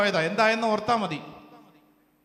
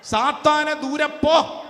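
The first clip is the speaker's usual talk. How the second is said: high, commanding rebuke; acted stern command, raised in pitch; voice of authority; 5 semitones higher, slower than usual. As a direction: order the enemy away with authority. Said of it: "അധികാരത്തിന്റെ സ്വരത്തിൽ അങ്ങ് പറഞ്ഞു".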